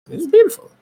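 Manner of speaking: very high, squeaky delight; mock rapture. Real, not acted. Mock rapture about toilet relief.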